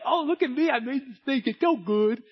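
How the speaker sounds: put-on loud, high-pitched proud kid showing off a clay snake; hammed-up childish gloating; boasting child. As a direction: brag like a child, ending in mock roars. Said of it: "and you're like".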